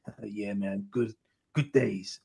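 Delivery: slow, low mock American accent; a hint of a slow American actor's twang. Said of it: "a really funny American twang accent".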